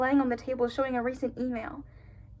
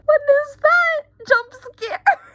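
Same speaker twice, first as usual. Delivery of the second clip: much higher and louder, slow and rattled; startled shock; 13 semitones higher, 8 dB louder, slower than usual. Real, not acted. Reacting to the creepy doll picture, shocked and loud. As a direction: recoil from the creepy image.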